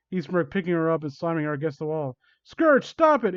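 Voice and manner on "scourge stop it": high, loud scream of protest. Acted. Amy screams at Scourge to stop, high and loud. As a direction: shriek it at him to stop. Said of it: "Amy screamed".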